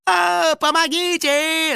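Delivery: very high, loud, stretched-out wail; screaming for help. A narrator's reading. House spirit speeding onto the highway, screaming for help. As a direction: shriek in panic, dragging out the word. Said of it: "завопил домовой".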